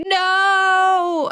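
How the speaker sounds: a high mock cry of no; retold anguished no. Reader retelling a character's death, a high mock wail of no. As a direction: lightly relive the moment of loss. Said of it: "I was like".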